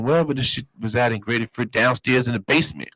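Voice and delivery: ordinary delivery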